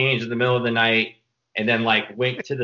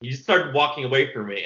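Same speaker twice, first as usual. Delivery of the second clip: ordinary talk, nothing marked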